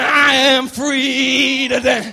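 loud, high and very slow shout; loud, drawn-out cry of freedom; joyful Lazarus. Acted. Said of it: "You'd be going".

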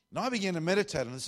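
usual speaking voice

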